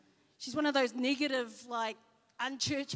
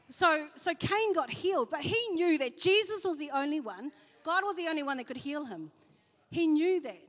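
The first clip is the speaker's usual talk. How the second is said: raised, louder preaching voice; 3 semitones higher, 8 dB louder than usual. Acted. Preacher pressing the point to the congregation, louder. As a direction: drive the point home from the pulpit.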